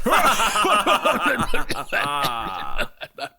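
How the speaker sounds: light laugh. Real, not acted. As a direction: laugh at the joke. Laughs after wallet joke.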